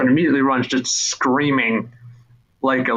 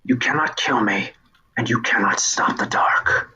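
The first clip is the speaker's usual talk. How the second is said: whispered herald. Acted. Herald whispering dismissal to the boy. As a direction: breathe it out, unbothered.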